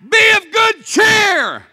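high, slow, shouted cheer; yelled out. Retold or imitated. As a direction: bellow it over the storm. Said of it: "he yells out".

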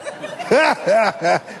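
short burst of put-on laughter; imitated laughter. Imitating a preacher laughing beside you, put-on burst of laughter. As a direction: act out a sudden chuckle in a few beats. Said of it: "he punched you and started laughing".

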